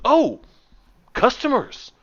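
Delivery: high, loud, flustered exclamation; surprised shop owner. Acted. Shop owner to her drake on noticing customers, surprised. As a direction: burst out as you suddenly spot customers.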